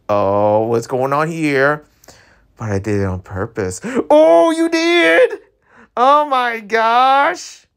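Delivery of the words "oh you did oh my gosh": high, slow drawn-out squeal; thrilled squeal. Real, not acted. Streamer hearing he did it on purpose, thrilled squeal. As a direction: stretch the squeal out, delighted.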